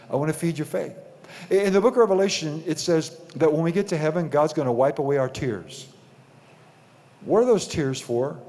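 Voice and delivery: ordinary talk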